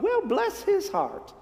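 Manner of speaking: high, slow, sugary put-down; mock-sweet Southern dismissal; syrupy condescension. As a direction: drip false sweetness on it. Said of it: "ancient equivalent of".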